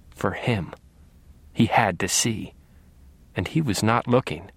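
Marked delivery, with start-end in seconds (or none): none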